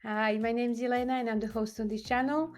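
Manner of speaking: normal talking voice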